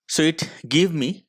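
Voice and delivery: ordinary delivery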